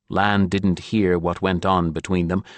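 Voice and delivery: ordinary delivery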